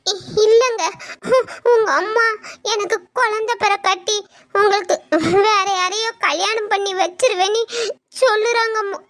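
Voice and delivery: sobbing, halting confession; choked-up wife; crying. Acted. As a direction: catch your breath between tearful phrases. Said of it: "அக்கா ஏன் இப்படி அழுறா?".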